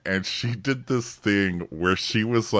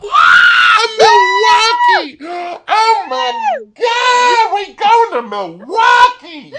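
shrieking far higher and much louder; mock screaming excitement; 12 dB louder, much slower than usual. Contestants hearing the Milwaukee trip, shrieking. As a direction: scream with fake ecstasy. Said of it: "they're like".

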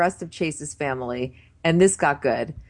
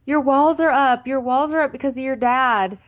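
mock mom; 7 semitones higher than usual. Mom quoted in recap.

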